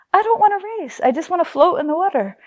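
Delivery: high, mock-whiny refusal; retold protest. Daughter refusing dad's race in the pool, high whiny protest. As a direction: plead lightly like a reluctant kid.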